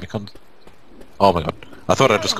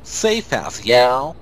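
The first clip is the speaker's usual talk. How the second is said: clumsy attempt at a Southern twang; 3 semitones higher, much slower than usual. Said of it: "I can't do a freaking redneck accent".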